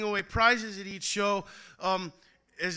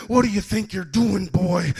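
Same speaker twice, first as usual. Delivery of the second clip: louder, scolding shout; mock scream; 11 dB louder than usual. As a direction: yell it like an angry crowd. People screaming at poet.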